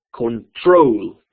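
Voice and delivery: "Control" con "trol" fuerte y alargado; acento final. Shown as a demonstration. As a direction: cargar la segunda sílaba de "Control". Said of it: "La segunda". ("Control" lento, recalcando "trol")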